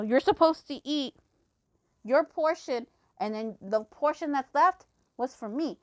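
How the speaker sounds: retold tirade, indignant and insistent; ticked-off rant. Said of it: "I went fucking off 'cause I was ticked".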